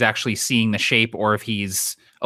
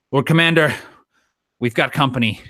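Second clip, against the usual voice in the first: calling out a warning; 3 semitones higher than usual. Acted. Warning commander of incoming threat.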